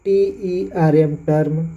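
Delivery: slow spelling then term; slow spelling demo. Shown as a demonstration. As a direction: teach term slowly. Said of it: "उसी प्रकार है term". (धीमे term)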